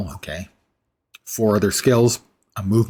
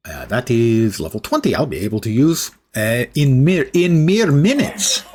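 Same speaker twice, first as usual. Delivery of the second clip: hammed-up fake Russian accent; deliberately bad Russian accent. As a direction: play a steel hero with a mock Russian accent. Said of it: "bad Russian accent".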